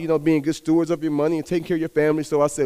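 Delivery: ordinary talk, nothing marked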